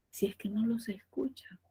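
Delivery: soft whispered ending. Shown as a demonstration. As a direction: drop to a whisper for effect. Susurro didáctico. (susurro didáctico para enfatizar)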